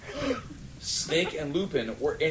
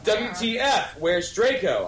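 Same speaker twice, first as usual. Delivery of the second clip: loud demand; 8 semitones higher, 4 dB louder than usual. A narrator's reading. Demanding question.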